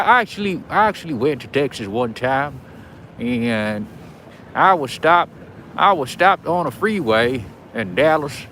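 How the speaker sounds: amateur Texas twang with lively, swinging pitch; Texas accent. Shown as a demonstration. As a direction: tell it in a playful Texan accent. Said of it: "Texas accent. I haven't done it".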